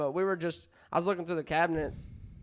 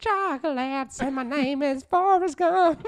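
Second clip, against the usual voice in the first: slow, higher Southern drawl as Forrest Gump; 10 semitones higher, slower than usual. Pretend. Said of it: "Let me try it with another feel".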